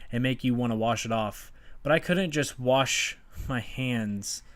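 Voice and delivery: impassioned spoken-word recital, punching each phrase opening; spoken-word poem. Acted. Poet telling listeners of childhood trauma, impassioned spoken-word delivery. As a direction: perform it as a personal poem, each phrase falling away.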